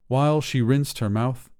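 ordinary delivery, nothing marked

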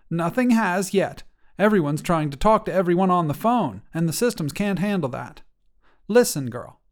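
a hint of a calm grandma explaining; unhurried at first, then matter-of-fact; grandma settling the girl down; lightly voiced grandmother.

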